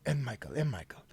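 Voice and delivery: whispered, calming his name; soft whisper. Acted. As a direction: hush him gently, voiceless. Calming Sir Michael in character.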